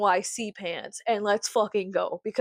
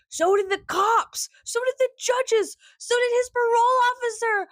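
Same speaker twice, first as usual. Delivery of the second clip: sassy comeback; 9 semitones higher than usual. Retold or imitated. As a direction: sneer it at her in a high voice. Mocking retort to high school girls.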